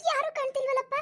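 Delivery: put-on high, near-crying old woman in a fright; a granny whose heart is pounding with shame; panicked old woman. Old woman realises a stranger came. (ಗಾಬರಿಯಿಂದ ಅಳುವಂತಹ ಎತ್ತರದ ಮುದುಕಿಯ ಧ್ವನಿ)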